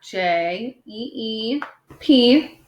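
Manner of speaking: J E E P spelled letter by letter, slowly; slow spelling. Shown as a demonstration. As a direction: spell it back slowly for the class. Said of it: "I'm gonna spell that back".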